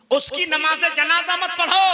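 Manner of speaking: high, emphatic pulpit delivery; forceful repeat. Real, not acted. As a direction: hammer the ruling home to the crowd. Preacher repeats ruling with force.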